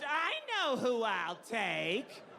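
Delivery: very slow, high, drawn-out gloating; mocking boastful kid. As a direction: mimic a smug boy, stretching every word upward. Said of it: "Charlie Bucket waltzes in, waving gold around".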